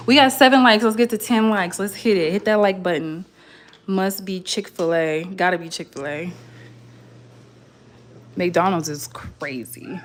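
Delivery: ordinary talk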